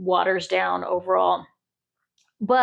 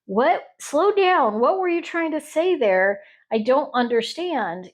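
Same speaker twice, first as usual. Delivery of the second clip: higher, puzzled "what?"; confused outsider; 5 semitones higher than usual. Retold or imitated. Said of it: "somebody from a different area may be like".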